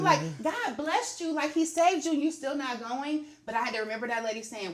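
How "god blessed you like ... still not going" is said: imitating upset scolding. Wife retelling how she scolded her husband, imitating upset. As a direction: replay the scolding with raised pitch. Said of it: "I was so upset with him".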